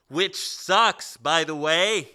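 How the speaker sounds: loud, slow, drawn-out contempt; scornful jab. Real, not acted. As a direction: stretch out the insult with disdain. Ranting about a bad remake.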